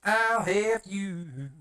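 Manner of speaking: high, slow villain voice; a hammed-up villain threat; menacing put-on voice.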